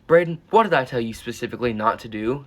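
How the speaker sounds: scolding teacher. Retold or imitated. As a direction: imitate a teacher confronting a student. Teacher confronts student in retold scene.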